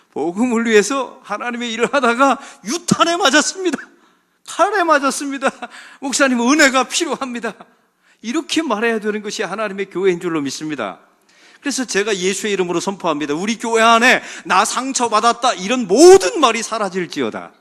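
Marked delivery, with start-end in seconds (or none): 0.1-7.6 high, loud, mock-dramatic cry; hammed-up battle report. Church member telling pastor of injury, hammed-up loud report. 11.6-17.5 loud declaration building to a ringing decree; preaching proclamation. Real, not acted. Preacher commanding hurt talk to vanish, full-force proclamation.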